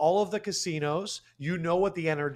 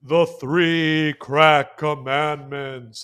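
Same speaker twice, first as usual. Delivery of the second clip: slow, louder, grand announcement; announcer voice; 6 dB louder, much slower than usual. Acted. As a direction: announce the title. Announcing show segment name.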